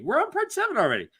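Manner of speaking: fast, high-pitched rush. Shown as a demonstration. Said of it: "I'm gonna try this again".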